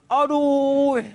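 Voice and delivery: high, drawn-out "aduh"; mock a worshipper groaning in pain; imitated pain groan. Old man aching as he prostrates, high exaggerated groan. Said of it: "sakit gamaknya".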